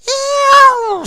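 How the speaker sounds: shrill, very high, loud yowl; hammed-up cat screech; cat scream. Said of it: "screamed the cat".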